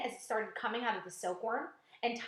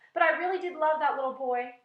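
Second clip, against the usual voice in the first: sobbing; 5 semitones higher, 8 dB louder than usual. A narrator's reading. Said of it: "the earthworm sobbed".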